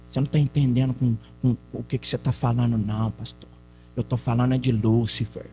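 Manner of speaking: voz grave e mais baixa, retratando o interlocutor; imitando o homem. Retold or imitated. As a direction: imite o homem, grave e contido. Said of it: "Desse jeito". (imitação grave do homem que contesta)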